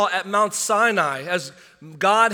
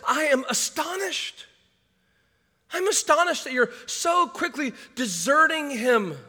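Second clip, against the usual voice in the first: shocked Paul; 7 semitones higher than usual. Retold or imitated. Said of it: "He is shocked".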